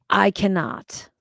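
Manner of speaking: ordinary talk, nothing marked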